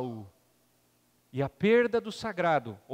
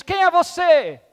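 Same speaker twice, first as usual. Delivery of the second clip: high loud call into the mountains; 11 semitones higher, 12 dB louder, slower than usual. Acted. Frightened boy calls out to the echo, high loud shout. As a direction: shout it across the valley.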